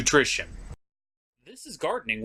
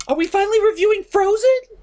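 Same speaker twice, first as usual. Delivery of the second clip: high squealing fan, far higher; gasp, then burst out thrilled; excited gasp; 4 dB louder, faster than usual. Acted. A giddy fan bursting in on the reviewer, squealing.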